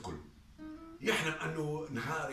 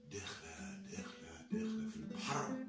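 softer and softer to a whisper; fading away; 7 semitones lower, 6 dB quieter than usual. A narrator's reading. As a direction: let it drift off into the sea. Boat drifting out, fading. (صوت يخفت حتى الهمس)

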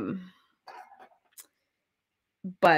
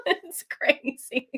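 high, slow, breaking into real laughter; laughing; 6 semitones higher, 5 dB quieter, much slower than usual. Real, not acted. Describing a wild British show, real laughing. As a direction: squeeze it out through laughter.

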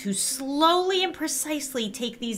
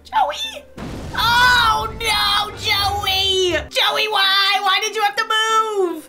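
higher, louder cries of dismay; distraught yelling; 10 semitones higher, 4 dB louder, much slower than usual. Real, not acted. Gamer yelling at her Sim who just lost, distraught. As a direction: yell at the screen in disbelief. Joey caught moving in the game.

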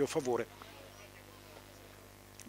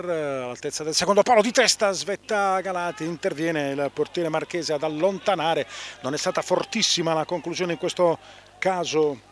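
high and loud play-by-play; excited commentary; 3 semitones higher, 7 dB louder than usual. Real, not acted. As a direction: call the chance with rising excitement. Header and save near goal.

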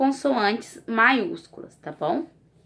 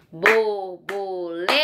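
slow, syllable by syllable; slow teacher's Borboleta; sounding out; 3 semitones lower, slower than usual. Shown as a demonstration.

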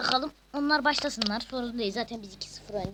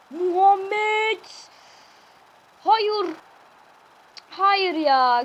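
frustrated shout; 8 semitones higher, 9 dB louder, much slower than usual. Real, not acted. Missed chance in live match.

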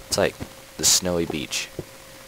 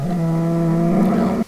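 high, loud, drawn-out no; wailed protest; 11 semitones higher, 6 dB louder than usual. Pretend. Player thwarted by a moving animal, high loud wail.

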